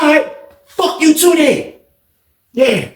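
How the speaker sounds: high-pitched taunting retort; talking greasy. Retold or imitated. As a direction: mouth off at him defiantly. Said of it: "he started talking greasy to Milk".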